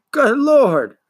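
high, loud mock shock; cried out. A narrator's reading. Shopkeeper crying out at the boy's failure, high and loud. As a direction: exclaim in theatrical dismay. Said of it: "cried Mister Coriander".

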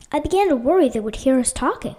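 ordinary delivery

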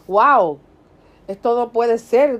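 amazed exclamation. Pretend. Believer receiving a miracle, stunned and amazed. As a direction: gasp it out as if you can't believe it.

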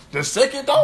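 high, loud hyped call; excited. Real, not acted. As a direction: hype it up. Hype about round two.

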